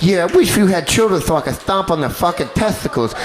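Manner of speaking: lisping cheerful menace. Retold or imitated. [name] taunting [name], high sing-song voice. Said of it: "[name] would be like".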